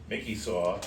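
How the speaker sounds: ordinary delivery, nothing marked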